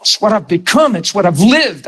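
ordinary talk

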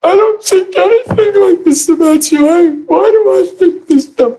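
high, loud half-wept pleading retold; imitating a desperate prayer through tears, mildly; retold crying plea. To the Holy Spirit on his bed, a retold tearful plea. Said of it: "I'm crying".

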